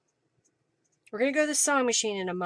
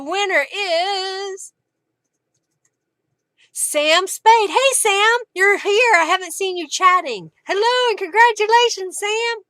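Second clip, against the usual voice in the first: high, loud, cheerful greeting; beaming shout-out to Sam; excited winner announcement; 8 semitones higher, 8 dB louder than usual. Real, not acted.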